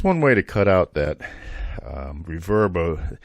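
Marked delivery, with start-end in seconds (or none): none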